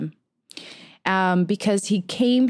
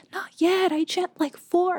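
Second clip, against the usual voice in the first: lightly voiced eager young kid, pitched higher; a hint of a small child answering the guru; kid's voice; 10 semitones higher than usual. Retelling a childhood talk with her mom's guru, in a higher child voice. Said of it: "And I was like".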